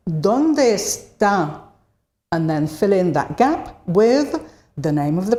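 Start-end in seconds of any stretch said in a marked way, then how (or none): 0.1-1.6 "Dónde está" at half speed, each word distinct; slow model phrase. Shown as a demonstration. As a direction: stretch out "Dónde ... está" for learners. Teacher models question for learners.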